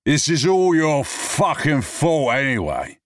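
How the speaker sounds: high, loud, slow slurred accusation; drunken blame. Acted. Drunk man blames James, high loud and slurred.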